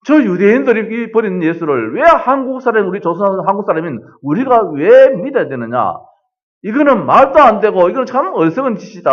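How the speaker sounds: hammed-up angry railway worker; imitating a furious worker. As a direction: retell the worker's abuse with rising scorn. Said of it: "대들어요. 욕을 해요".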